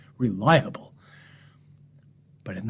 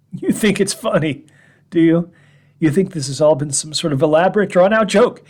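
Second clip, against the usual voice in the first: bitter outburst; 4 semitones higher, 5 dB louder than usual. Acted. Man mocks imagined laughing listeners.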